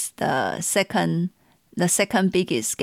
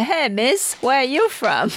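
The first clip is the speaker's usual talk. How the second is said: higher-pitched mock driver's question; imitated taxi driver; 6 semitones higher than usual. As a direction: playfully act out a curious cabbie calling back. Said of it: "they always turn around and say".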